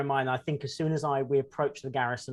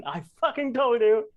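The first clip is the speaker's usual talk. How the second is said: much higher, louder in-character outburst; acted indignant shout; 10 semitones higher, 8 dB louder than usual. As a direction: shout it as a hammed-up I-told-you-so.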